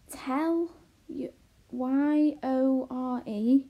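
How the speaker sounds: loud, laboured deciphering; spelling out. A narrator's reading. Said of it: "began to spell out the letters".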